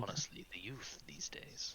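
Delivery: hushed, tutting elder's grumble; disapproving villain. Acted. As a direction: lament the young under your breath. Villain grumbling about the young.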